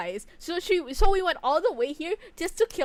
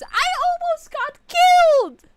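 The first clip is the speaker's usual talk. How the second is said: high, slow, shrieking yell; drawn-out furious screech; screeching outrage; 12 dB louder, slower than usual. Acted. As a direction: scream it at her like she wronged you. Yelling at game character.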